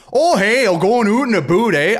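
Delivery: high, hammed-up Canadian accent; mock a Canadian with exaggerated vowels; caricatured Canadian greeting. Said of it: "if I go somewhere and they go".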